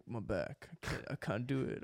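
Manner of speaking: put-on weak, quiet stab at an Australian accent; attempted Australian accent. Friend mocks Ben Simmons in a soft, failed Australian accent. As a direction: mumble it in a half-hearted Australian accent. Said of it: "that was my attempt at an Australian accent".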